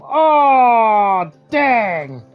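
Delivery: ordinary talk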